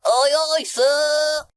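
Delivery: high, loud monkey sailor's reply; very high, loud character voice; shouting back. A narrator's reading. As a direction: shout back eagerly to the captain. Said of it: "Mike shouted back".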